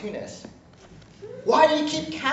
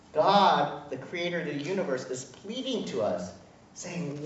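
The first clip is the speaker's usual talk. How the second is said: normal talking voice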